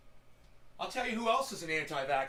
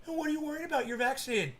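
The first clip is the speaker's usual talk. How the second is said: higher, softer mock-questioning voice; mimicking anti-vaxxers; 8 semitones higher, 3 dB quieter, faster than usual. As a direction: imitate a dismissive anti-vaxxer, pitched up.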